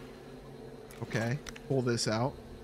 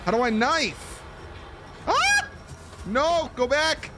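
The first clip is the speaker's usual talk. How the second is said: high, panicked and frantic; being chased; 14 semitones higher, slower than usual. Real, not acted. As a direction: scramble in real panic.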